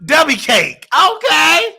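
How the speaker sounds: high teasing shout. Real, not acted. Host teasing caller's weight.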